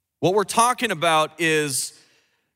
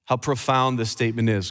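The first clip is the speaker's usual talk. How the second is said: ordinary delivery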